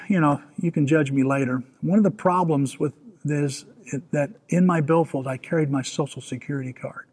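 ordinary talk

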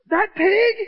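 high, loud, rising disbelief; incredulous question. Retold or imitated. Player hears they will eat it, incredulous. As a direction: ask it aghast, pitch shooting up.

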